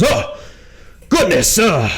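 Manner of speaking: high, flustered captain voice. Acted. Captain looks up and sees the adventurers, flustered. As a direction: play a surprised officer collecting himself.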